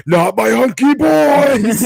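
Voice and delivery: high, loud, drawn-out wail; mock anguished scream. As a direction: howl in hammed-up horror over treasured things. Quotes villain's outcry over magazines.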